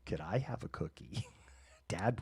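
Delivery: whispered, sneaking a request; sneaky whisper. Retold or imitated. Cat sneaking to dad for a cookie, whispered. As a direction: whisper like a child plotting behind mom. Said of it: "three-year-old mode".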